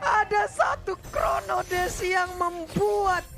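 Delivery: excited. Real, not acted. Shouting call of a big play.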